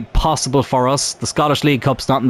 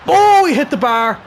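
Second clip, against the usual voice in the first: startled cry; 10 semitones higher than usual. Real, not acted. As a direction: yelp as the shot hits the bar. Opponent hits the bar.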